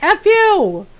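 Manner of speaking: loud, high-pitched comic yell; mock hateful outburst. Animals who hate the farmer cursing her, mock-angry yell. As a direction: burst out in pretend fury. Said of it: "they hate me".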